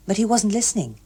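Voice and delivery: ordinary delivery, nothing marked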